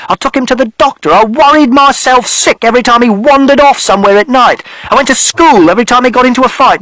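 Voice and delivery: rising tirade of grievances; father shouting. A narrator's reading. Father yelling at mother, building to rage. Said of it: "And father shouted".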